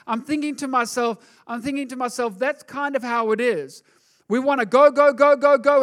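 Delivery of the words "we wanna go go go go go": louder, higher and insistent; eager go go go. Retold or imitated. Preacher mimicking people rushing their own way, eager and loud. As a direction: rush ahead like an impatient crowd.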